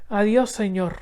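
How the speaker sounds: put-on light, high girl's voice; young girl Isabel. A narrator's reading. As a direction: lift the voice up for the little girl.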